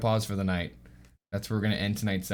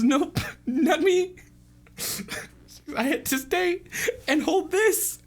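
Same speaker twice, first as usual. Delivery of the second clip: high falsetto, halting phrases; timid high-pitched character voice; shy twin. Acted. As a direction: answer in a small squeaky voice. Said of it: "the more shy twin".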